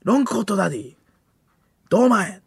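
high-pitched announcer roll call; hammed-up show announcement; mock finalist announcer. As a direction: call each act like a TV announcer.

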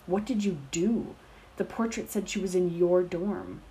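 a hint of a boy accusing a rival; damning accusation. A narrator's reading. Severus accusing James, lightly voiced damning demand. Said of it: "Severus's voice was damning".

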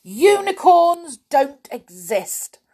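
high, loud, slow insistence; stubborn denial. Acted. Stubborn listener contradicting Mister Bump, insistent. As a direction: insist loudly, word by word.

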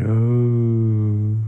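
loud knowing "Oh"; a big aha from dad; realizing oh. Acted.

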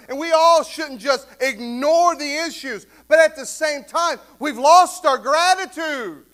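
loud, slow, building sermon; preaching at full force. Acted. Pastor rebuking the church for losing gratitude, preaching loud. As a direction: preach it hard to the congregation.

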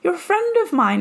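high, wounded protest to a friend; hurt disbelief. Retold or imitated. Retelling what she told the friend, hurt and high. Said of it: "I was like".

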